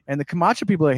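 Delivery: usual speaking voice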